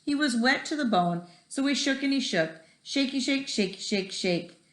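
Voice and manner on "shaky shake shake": ordinary delivery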